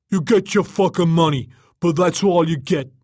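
angry snap. A narrator's reading. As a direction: snap it out forcefully, no bargaining. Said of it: "Mal snapped".

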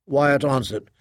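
plain reading voice

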